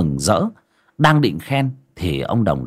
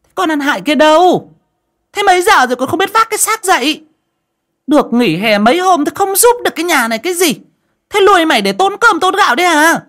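much higher, louder shrieking stepmother; shrill scolding; 5 dB louder than usual. A narrator's reading. Stepmother Vũ yelling into the room at the girl, shrieking. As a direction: screech at the lazy girl. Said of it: "mụ quay vào trong buồng quát".

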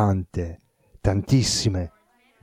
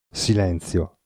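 plain reading voice

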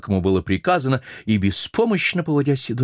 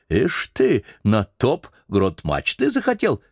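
bossy sailor; 3 semitones higher, slower than usual. Acted. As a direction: lord it over the old cook. Stubb mocks the cook.

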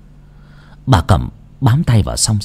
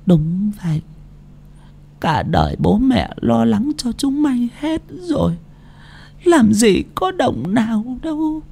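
put-on soft high frail old voice; 13 semitones higher, 5 dB quieter than usual. A narrator's reading. Old mother sadly explains.

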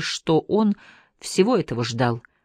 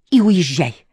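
louder, snapped-out shout; 6 dB louder, slower than usual. A narrator's reading. Anne shouting back at Randall to leave. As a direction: fling it back at him loudly. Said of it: "крикнула Энн".

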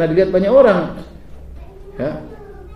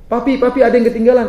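sweet wife calling; 7 semitones higher, 3 dB louder than usual. Retold or imitated.

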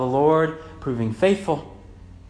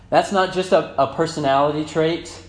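loud, raised exhortation; forceful preaching; 6 semitones higher, 7 dB louder than usual. Real, not acted. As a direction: press the congregation hard on the point.

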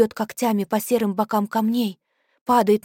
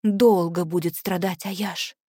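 usual speaking voice

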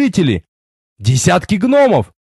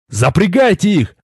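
usual speaking voice

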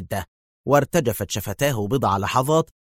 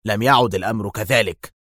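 plain reading voice

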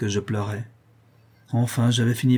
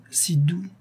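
tender echo; 6 semitones higher than usual. A narrator's reading. Lorraine remembers the Goualeuse's eyes, tenderly repeating. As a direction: let the second si doux melt away tenderly.

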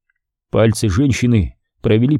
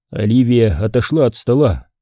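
plain reading voice

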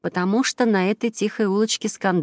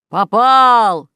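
loud, raised cheer on a hit; triumphant shout; 5 semitones higher, 10 dB louder than usual. A narrator's reading. Dad scoring in a pillow fight, triumphant shout. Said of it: "кричал папа Ивар".

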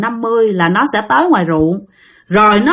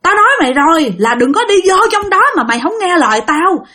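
higher-pitched, exasperated telling-off; angry scolding; 6 semitones higher than usual. Acted. Aunt scolds the woman for ignoring warnings, angry. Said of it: "Bà Sáu bà chửi rằng".

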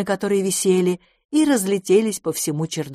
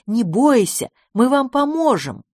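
higher, raised calling voice; encouraging shout; 7 semitones higher, 4 dB louder than usual. A narrator's reading. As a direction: call up to reassure. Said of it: "закричала ему Добронрава". (ободряющий крик)

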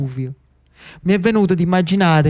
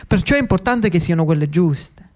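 ordinary delivery

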